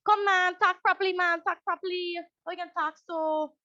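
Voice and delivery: mock-scolding parent, pushed up and loud; impatient scold. Parent scolding a child with a speech impediment, impatient. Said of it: "very impatient response".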